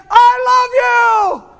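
high, drawn-out shout of love; shouted declaration. Acted. Jesus declaring love to us, loud and drawn-out shout.

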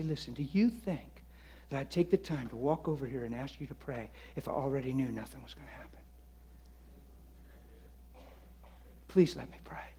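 very soft, half-whispered plea; urging a hesitant stranger, almost whispered; hushed plea. Shown as a demonstration. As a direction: lean in and plead quietly. Soft persuasive demo.

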